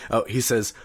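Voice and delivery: ordinary talk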